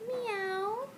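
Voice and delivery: high soft meow; kitty meow. Shown as a demonstration. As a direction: meow like a cat. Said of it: "Does the kitty say meow?".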